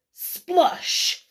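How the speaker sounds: ordinary delivery, nothing marked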